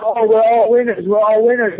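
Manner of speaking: hammed-up whiny kid voice; mocking coddled kids. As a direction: parrot them like a pampered child. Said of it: "all these little kids that growing up".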